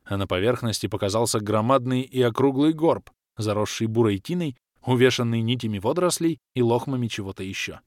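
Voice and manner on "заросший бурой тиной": ordinary delivery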